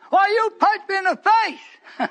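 high, slow fake outrage with a laugh; mocking accuser. Preacher mocking the man's claim of assault. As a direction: ham up the false complaint, laughing.

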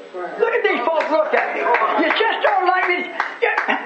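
high, impassioned plea from the pulpit; pleading preacher. Acted. Preacher pleading with his congregation, pulling hard at them. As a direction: beg them to listen, voice climbing.

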